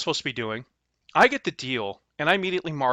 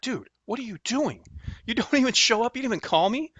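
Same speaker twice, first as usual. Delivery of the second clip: higher, half-laughing mock rant at a no-show; retold exasperation; 8 semitones higher than usual. To the buyer who never showed, a mock exasperated rant. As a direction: replay the irritation, pitched up and incredulous. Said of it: "I'm already mad".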